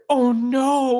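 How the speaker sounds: sharp high dismayed cry; sudden loud dismay; dismayed gasp. Acted. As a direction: blurt it out, heartbroken. Lena crestfallen gasp.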